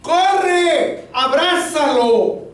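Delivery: high, drawn-out urgent call; commanding voice. Retold or imitated. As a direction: call it out as a sudden, long-held command. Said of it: "una voz que me dice".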